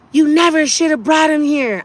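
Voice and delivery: mild mock yell, pitched high; retold scream. Daughter quoting what mom screamed from her apartment, mild retold yell. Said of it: "what my mom screamed out of her apartment".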